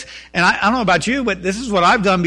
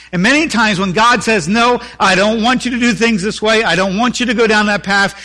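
higher, louder preaching; 4 semitones higher, 4 dB louder than usual. Acted. Pastor preaching God's answer to the congregation, full force. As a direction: press the message on them.

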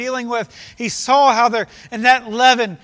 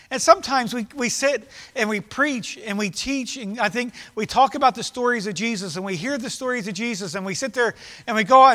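ordinary talk, nothing marked

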